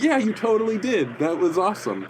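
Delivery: high, gushing retold reply; acted fan enthusiasm. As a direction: gush back to your idol. Acts his starstruck reply.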